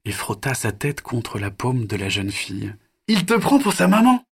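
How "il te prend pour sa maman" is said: put-on much higher, bright little girl teasing her brother; a delighted kid nudging her brother; delighted child voice. Pauline teasing Axel about the baby dinosaur, high excited child voice.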